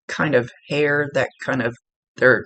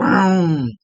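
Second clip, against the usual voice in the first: a loud, higher vroom of a revving engine; quick imitated engine roar; imitated engine rev; 5 semitones higher, 5 dB louder than usual. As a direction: mimic the truck roaring to life. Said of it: "it was like".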